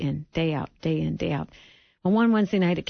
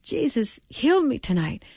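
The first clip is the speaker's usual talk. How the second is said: excited announcement; 7 semitones higher than usual. Retold or imitated. Son announcing healing.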